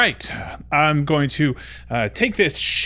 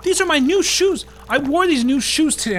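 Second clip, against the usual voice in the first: upset; 12 semitones higher, 3 dB louder than usual. Acted. Water spilling on his shoes.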